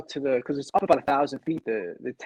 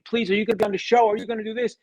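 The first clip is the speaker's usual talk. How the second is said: lightly voiced eager fan, higher; imitating young fans; 7 semitones higher than usual. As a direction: gush like an adoring kid fan, half-mimicked. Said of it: "from kids, you know, writing to me".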